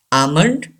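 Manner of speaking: almond as "AH-mund"; almond, drawn "ah", then "mund"; silent L, long A. Shown as a demonstration. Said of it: "A gives the long sound".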